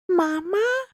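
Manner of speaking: put-on high, small child-ghost voice; tiny voice. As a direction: squeak it softly as an eerie little question. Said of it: "a tiny voice say".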